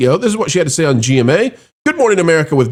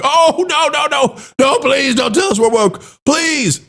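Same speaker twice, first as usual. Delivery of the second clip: mock pleading; 9 semitones higher than usual. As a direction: beg like a company caught out. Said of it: "claims the company's woke".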